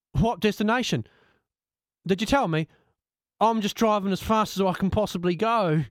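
a driver who was never told where to go; puzzled taxi driver. Acted.

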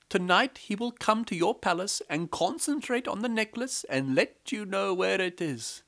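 high, persuasive wife voice; wife to the king. A narrator's reading. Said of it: "went to the king".

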